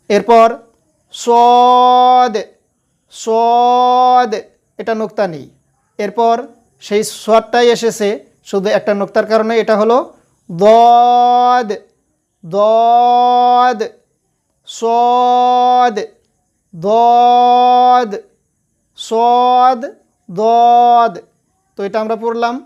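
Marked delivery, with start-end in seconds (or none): 1.1-4.3 সদ held long with a heavy tone; drawn-out recitation. Shown as a demonstration. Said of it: "যেভাবে পড়ছি আমি". 10.5-21.1 দদ and সদ each held long and even; contrasting letters drawn out. Shown as a demonstration. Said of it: "যেভাবে পড়ছি আমি".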